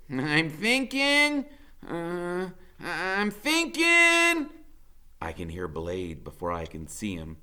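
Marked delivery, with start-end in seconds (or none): 0.1-4.5 slow, drawn-out and high; slow thinking. A narrator's reading. Freak stalling while cornered, slow drawn-out musing. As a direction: stretch each word like you're pondering. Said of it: "He goes".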